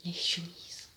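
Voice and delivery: whispered, savouring the word; soft voiceless echo; hushed repeat. Real, not acted. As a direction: drop to a whisper for effect.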